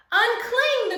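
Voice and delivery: high, loud call up the tree; crowd calling. A narrator's reading. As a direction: urge him to let go. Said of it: "the crowd called".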